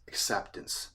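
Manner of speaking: a breathy, hushed whisper of the word "acceptance."; whispering "acceptance." as a secret key word. Shown as a demonstration. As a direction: let "acceptance." drop to a near-silent whisper. Whispered key word before plain repeat.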